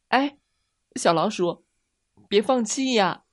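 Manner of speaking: high, loud rabbit's plea; crying out. A narrator's reading. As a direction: call out urgently to stop a friend. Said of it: "小兔子大叫道". (小兔子高声大叫)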